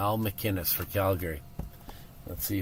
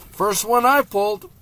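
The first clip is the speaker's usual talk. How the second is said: excited; 10 semitones higher, 8 dB louder than usual. Real, not acted. Pulls prized jersey.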